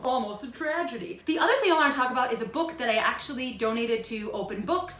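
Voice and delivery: usual speaking voice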